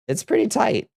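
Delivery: squeaky high answer. Pretend.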